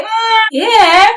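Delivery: imitating husband's reluctant, high-pitched reply; a hesitant high whine of half-hearted agreement; unenthusiastic. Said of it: "that is not showing excitement".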